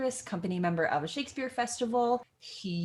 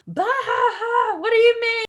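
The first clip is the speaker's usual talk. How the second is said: hammed-up, high, loud wailing rant; big comic squawk of disbelief; Nurse; 11 dB louder than usual. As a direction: cackle and wail in mock outrage. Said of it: "goes into this hilarious rant saying".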